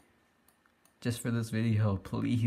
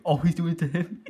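laughing, raised and louder; 6 semitones higher, 6 dB louder than usual. Real, not acted. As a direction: crack up while reacting in disbelief. Amused at prank in game.

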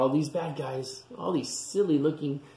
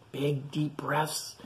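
ordinary delivery, nothing marked

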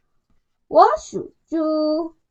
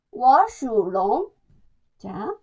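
normal talking voice

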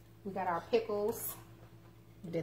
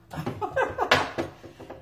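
laugh. Real, not acted. Laughs at the big plate.

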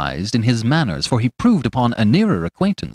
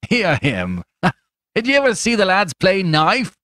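high-pitched mocking rogue; jeering stranger; 9 semitones higher than usual. A narrator's reading. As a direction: jeer at him in a lifted, coarse character voice.

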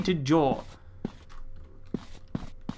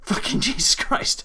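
breathless whisper; 8 semitones higher than usual. Real, not acted. After a scare.

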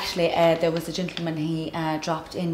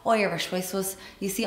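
ordinary talk, nothing marked

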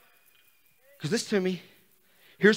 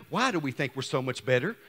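ordinary delivery

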